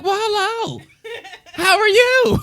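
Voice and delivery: high, laughing sing-song hello; sing-song greeting. Retold or imitated. Greeting the woman at Walmart, high sing-song. Retold sweet greeting to stranger.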